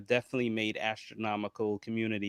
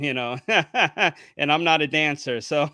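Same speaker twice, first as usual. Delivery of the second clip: louder, laughing through the joke; laughing at himself; 5 semitones higher, 9 dB louder than usual. Real, not acted. Podcast host jokes to his guest about TikTok, laughing loudly. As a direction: chuckle loudly at your own line about not dancing. Joking he is no dancer.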